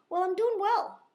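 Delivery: thrilled to report on the match; excited. Real, not acted. Player telling viewers she's winning, excited and loud.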